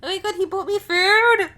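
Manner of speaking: thrilled to find he bought her food; excited squeal. Acted. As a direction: squeal with delight at the gift. Delighted by the gift.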